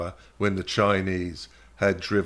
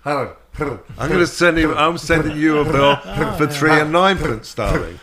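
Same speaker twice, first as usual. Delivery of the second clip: posh voice; 6 semitones higher, 6 dB louder than usual. Pretend. Jokey posh shopkeeper billing.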